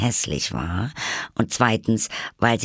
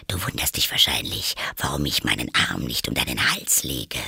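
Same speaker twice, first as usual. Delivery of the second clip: low-pitched, chummy duchess voice; moralizing duchess; 7 semitones lower than usual. Acted. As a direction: play a duchess cosying up to Alice. (tiefe, vertrauliche Herzoginnenstimme)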